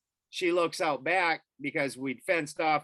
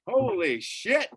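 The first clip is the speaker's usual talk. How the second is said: raised-pitch urgent exclamation; acted alarm; 8 semitones higher, 4 dB louder than usual. Husband telling wife to get the kids inside, alarmed. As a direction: call out an urgent order, higher than usual.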